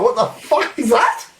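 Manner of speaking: startled high yelp of a question; sudden jump way up in pitch, alarmed; grabbing false teeth in the dark, shocked. Retold or imitated. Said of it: "I was like".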